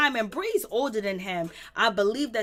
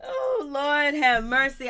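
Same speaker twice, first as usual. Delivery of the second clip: laughing sigh; 3 semitones higher, 4 dB louder, slower than usual. Real, not acted. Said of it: "laughing exclamation".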